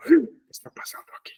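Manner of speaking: hushed, puzzled whisper to himself; a startled inner whisper; whispered bewilderment. Retold or imitated. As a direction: breathe the question as if thinking aloud. Recalling his own bewildered thought.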